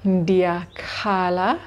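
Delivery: Ndiyakhala said slowly, every syllable clear; teacher modelling Ndiyakhala slowly; slow model pronunciation. Shown as a demonstration. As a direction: carefully sound out Ndiyakhala for the class. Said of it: "I am crying".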